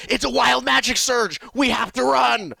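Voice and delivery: high, loud panicked-wizard yell; urgent, high-pitched holler; yelled warning. Acted. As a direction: shout it out in alarm to everyone. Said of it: "yells out".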